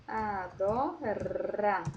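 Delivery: Adora said slowly, the tap R lightly flapped on the tongue tip; slow single-R demo. Shown as a demonstration. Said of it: "Olha como é diferente". (Adora devagar, R na ponta da língua)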